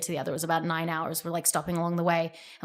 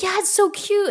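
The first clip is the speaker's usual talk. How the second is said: high, cooing delight; gushing agreement; 12 semitones higher than usual. Retold or imitated. Mother answering daughter about the crab, retold gushing. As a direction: gush along with a child, pitched way up.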